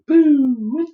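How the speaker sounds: sudden high, loud mock scare; a goofy startle shout; playful boo. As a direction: jump out with a silly boo. Teacher playfully startles class.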